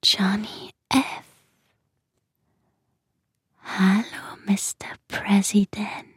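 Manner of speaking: breathy secret whisper to a teddy; child whispering tenderly to her teddy bear. Acted. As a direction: hush it like a secret at bedtime.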